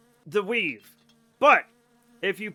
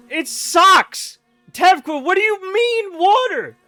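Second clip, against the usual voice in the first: much higher, loud disbelieving outburst; incredulous shout; 11 semitones higher, 10 dB louder, slower than usual. Real, not acted. Streamer shouts at viewer Tevkwa in outraged disbelief.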